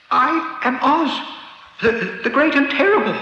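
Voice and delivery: high, frightened, stammering voice; trembling voice. A narrator's reading. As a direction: stammer it out, terrified. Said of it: "in a trembling voice".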